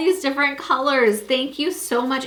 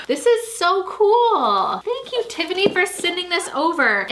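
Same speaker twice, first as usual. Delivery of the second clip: excited squeal; 7 semitones higher than usual. Real, not acted. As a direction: gush over the gift. Opening a fan gift excitedly.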